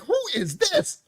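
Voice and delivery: high, slow, laughing puzzled question. Retold or imitated. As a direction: laugh through a confused who-is-this. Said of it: "I know the people like".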